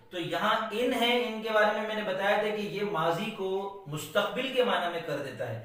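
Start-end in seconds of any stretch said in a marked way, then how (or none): none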